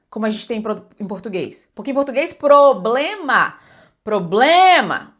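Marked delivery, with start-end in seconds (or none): 2.3-3.6 PROBLEMA slow and loud, pro-ble-ma all fully voiced; drawn-out "problema" with three clear vowels; every syllable clear. Shown as a demonstration. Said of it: "falo ma com clareza". 4.0-5.2 PROBLEMA with a long, loud BLE; proBLEma, middle syllable stretched out; stress on BLE. Shown as a demonstration. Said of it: "o blem é a mais forte".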